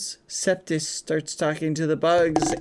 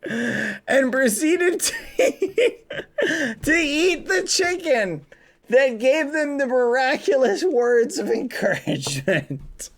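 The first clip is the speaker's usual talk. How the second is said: laughing; 7 semitones higher than usual. Real, not acted. Laughing while reading absurd story.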